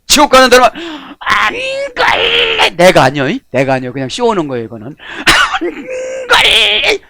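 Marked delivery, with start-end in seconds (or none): 1.2-2.7 high cry of refusal; mock refusing shout. 5.0-7.0 high cry of refusal; mock refusing shout.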